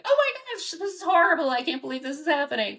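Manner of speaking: hammed-up owner panic; mock panic. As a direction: overreact like fireworks are a disaster. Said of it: "instead of jumping and screaming".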